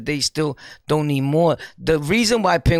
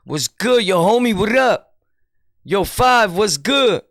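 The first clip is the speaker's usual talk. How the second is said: louder, hyped-up mock street greeting; street slang talk; 5 semitones higher, 4 dB louder than usual. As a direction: mimic a loud street dude hollering greetings. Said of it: "He's not talking like".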